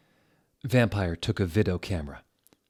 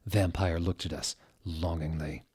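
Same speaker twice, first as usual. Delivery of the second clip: ordinary talk, nothing marked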